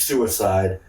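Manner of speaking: ordinary talk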